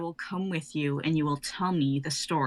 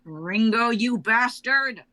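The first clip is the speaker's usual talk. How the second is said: loud, slow furious cry; angry yell; 4 semitones higher, 7 dB louder, slower than usual. Acted. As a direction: curse the killer. Sister's killer confronted.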